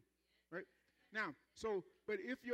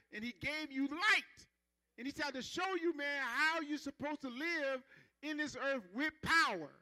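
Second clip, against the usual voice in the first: full-force preaching; 4 semitones higher, 5 dB louder than usual. Acted. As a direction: hammer it home to the congregation.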